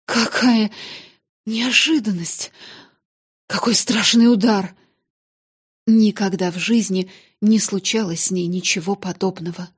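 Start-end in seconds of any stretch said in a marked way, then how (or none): 0.0-4.8 breathless. Acted. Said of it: "задыхаясь".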